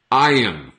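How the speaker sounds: iron said EYE-un with no R; correct pronunciation. Shown as a demonstration. Teacher modelling iron for students. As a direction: drop the R in iron. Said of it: "commonly pronounced as".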